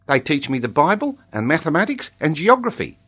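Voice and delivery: put-on high-pitched boy pupil voice; eager schoolboy. A narrator's reading. Tolini tells the lady what they teach him, high schoolboy voice. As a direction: recite your subjects in a high child's voice.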